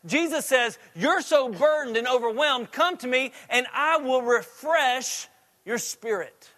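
high, loud, driving sermon; fervent preacher pleading with the congregation; preaching at full force. Acted. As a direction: build to a passionate peak. Preaching climax.

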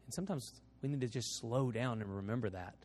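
soft, low invitation; preaching altar call. Acted. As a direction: speak it gently over music. Closing altar call prayer.

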